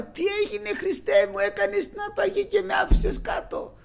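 high, sing-song wailing lament; crying in panic. Retold or imitated. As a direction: wail it like a frightened sister left behind. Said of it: "Και να κλαίει". (θρηνητικό κλάμα, ψηλά, σαν τραγούδι)

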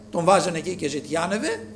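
ordinary delivery

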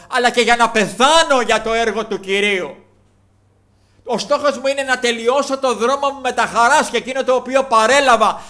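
loud, high preaching climax; fiery preaching. Acted. Preaching at full force. (δυνατό, φλογερό κήρυγμα)

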